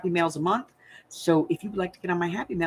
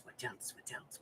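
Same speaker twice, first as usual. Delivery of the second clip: unvoiced whisper, trailing off; fading whispered repetition; whispered musing; 26 dB quieter than usual. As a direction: whisper it to yourself while thinking.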